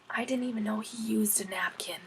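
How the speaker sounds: soft unvoiced whisper; whispered aside. A narrator's reading. Boy whispering a joke about Max to Jessie, hushed whisper. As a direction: whisper it behind your hand. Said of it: "I whispered to Jessie".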